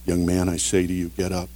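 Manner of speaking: calm authority. Shown as a demonstration. Preacher showing Jesus calmly raising the young man, soft and quiet. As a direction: say it gently but with full authority. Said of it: "But Jesus said it calmly, with authority".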